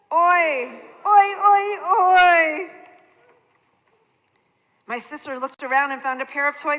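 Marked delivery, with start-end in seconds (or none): 0.1-2.7 loud high mocking oi; imitating aunt. Child mocking her aunt, loud and high. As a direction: ham up the aunt's sigh. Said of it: "What did she say?".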